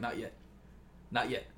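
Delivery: soft, low-key imitation of a mentor; restrained refusal. Batman holds Dick back on the rooftop, quiet and restrained. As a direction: hold them back quietly.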